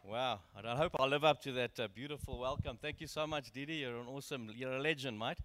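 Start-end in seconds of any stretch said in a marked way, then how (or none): none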